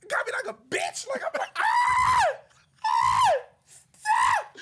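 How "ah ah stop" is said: high, loud yelps of a man being grabbed; loud hammed-up cries of a man grabbed by a stronger woman; mock panicked yelps. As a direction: squeal like you've been lifted off the floor. Acting out being manhandled.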